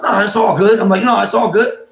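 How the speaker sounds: high, loud, pointedly casual brush-off; mock-breezy refusal. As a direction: wave it away with loud false ease. Acted refusal with attitude.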